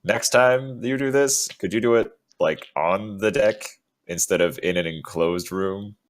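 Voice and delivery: shouting. Acted. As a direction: call it down the stairs. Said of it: "just kinda shout".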